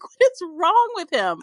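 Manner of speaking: exasperated. Retold or imitated. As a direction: ham up the disbelief. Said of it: "That's what I'm like".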